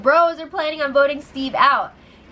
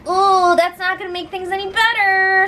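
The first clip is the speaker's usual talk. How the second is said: knowing ooh; 8 semitones higher than usual. Real, not acted. Host commenting on Bailey stirring trouble, teasing ooh. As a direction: relish the drama coming.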